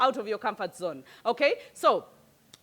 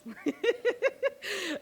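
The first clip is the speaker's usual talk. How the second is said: a real laugh that jumps up high; high amused laugh; 8 semitones higher, 4 dB quieter than usual. Real, not acted. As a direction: laugh freely at the joke. Laughing after the quoted complaint.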